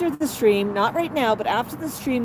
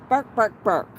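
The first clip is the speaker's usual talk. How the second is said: imitating parrot. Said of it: "he says".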